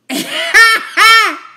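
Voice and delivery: loud high cackling laugh; host laughs at the cat scratching Nene, loud laugh; burst of laughter. Real, not acted. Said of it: "Not the throat, Nene".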